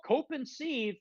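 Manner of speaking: snide sing-song mock of cope and seethe; mocking quote. Mocking the passive aggressive ex-friend's jab. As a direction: sneer it like a smug troll. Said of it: "to do this passive aggressive".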